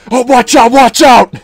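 loud, high mock alarm; hammed-up wrestling shout; eighties wrestling commentator. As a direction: yell a frantic ringside warning. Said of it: "eighties W W E".

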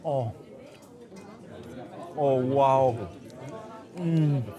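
chewing while talking; savouring a hot spoonful; mouth full. Real, not acted. Seen 0: Spoon to mouth at -5; lips working, eyes closed while savouring.

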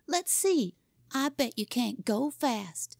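put-on high-pitched childish dare; demanding. A narrator's reading. Boy dares his rival to show speed, demanding. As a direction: challenge him, starting high. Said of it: "he demanded".

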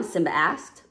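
plain reading voice

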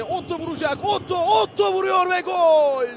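screaming football commentator. Real, not acted. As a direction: climb in pitch into the goal. Goal call in live commentary. (heyecanla yükselen gol anonsu)

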